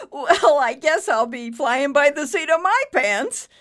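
loud, laughing, high. A narrator's reading. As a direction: say it through a hearty laugh. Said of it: "with a heartfelt laugh".

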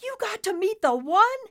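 very high, loud, astonished question; stunned. Acted. Nimbus asking his father, stunned. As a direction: gasp it out in amazement. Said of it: "asked, stunned".

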